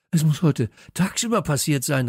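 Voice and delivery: excited loud exclamation; calling out. A narrator's reading. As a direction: call out breaking news. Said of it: "rief Kalle".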